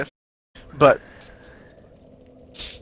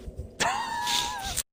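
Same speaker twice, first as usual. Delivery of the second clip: high squeaky giggle; 11 dB quieter than usual. Real, not acted. Laughing at own joke.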